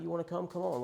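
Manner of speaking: high, sulky repeat of the invite; bad attitude. Inviting the friend again, sulky and high-pitched. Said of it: "real bad attitude about it".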